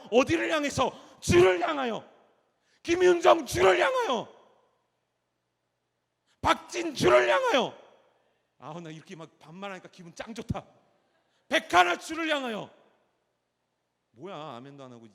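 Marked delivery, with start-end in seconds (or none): 0.1-7.8 loud, high call to come forward; rallying shout. Real, not acted. Calling congregants out by name. 11.5-12.7 high, loud shout of 백하나's name; loud call. Real, not acted. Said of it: "이렇게 젠틀하게 해야 돼요".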